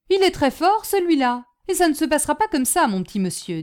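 high, loud, rapid threat; blustering, scornful porter; angry porter. Acted. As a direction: warn the boy off indignantly.

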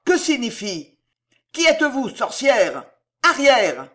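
loud, high outraged demand; thundering challenge. A narrator's reading. Officer confronting Cato, thundering shout. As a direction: bellow at the intruders to get back. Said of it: "tonna une voix".

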